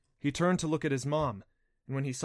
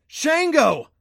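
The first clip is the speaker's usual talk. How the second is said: very high, loud shout of wonder; awestruck cry; 15 dB louder than usual. A narrator's reading. Adam recognizes the thunder god, crying out in wonder. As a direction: cry out the name in amazed recognition. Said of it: "Adam cried with dawning wonder".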